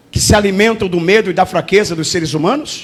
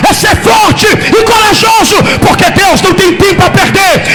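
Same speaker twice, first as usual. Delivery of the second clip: relentless rhythmic sermon shout; impassioned preacher at full voice; full-force preaching; 7 semitones higher, 4 dB louder than usual. Real, not acted. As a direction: exhort the congregation phrase after phrase.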